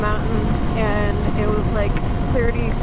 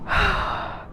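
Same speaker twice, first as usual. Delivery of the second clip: cold shock gasp; 10 semitones higher, 4 dB quieter than usual. Real, not acted. Gasping in freezing water.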